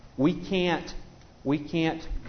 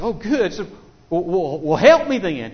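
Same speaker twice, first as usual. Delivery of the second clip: relieved pleading; 4 semitones higher, 7 dB louder than usual. Retold or imitated.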